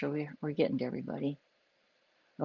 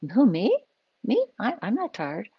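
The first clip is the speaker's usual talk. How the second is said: higher and louder, startled; caught-off-guard denial; 7 semitones higher, 5 dB louder than usual. Retold or imitated. Tired person told they look tired, denies it startled. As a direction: brush the question off in surprise. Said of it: "if the other person goes".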